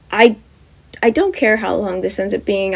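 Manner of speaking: plain reading voice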